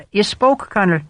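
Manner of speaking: high, hammed-up Irish lilt for Hogan; exaggerated Irish accent. A narrator's reading. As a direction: lay the Irish brogue on thick, mocking.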